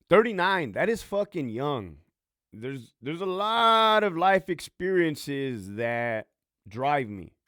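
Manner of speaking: loud, high and slow, hammering the point; emphatic outburst. Real, not acted. Ex-con podcaster insisting to his listeners he is still young, loud emphatic outburst. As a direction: insist on it, leaning hard on each word.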